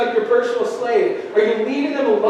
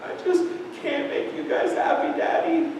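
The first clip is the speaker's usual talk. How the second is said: put-on higher, hushed heartbroken little boy; sad toddler; 5 semitones higher, 7 dB quieter than usual. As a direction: sound like a crushed two-year-old. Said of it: "he looked up at me with these sad eyes".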